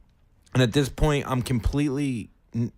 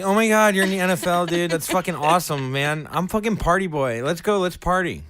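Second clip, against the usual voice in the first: higher, louder, pumped fan gushing; hyped-up party guy; 7 semitones higher, 4 dB louder than usual. Retold or imitated. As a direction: gush at the player like a pumped fan. Retells hyped meeting with NFL player.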